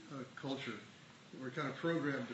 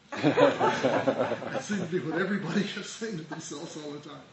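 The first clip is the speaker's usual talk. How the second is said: laughing; 2 semitones higher, 12 dB louder, slower than usual. Real, not acted. Amused remark on mantra.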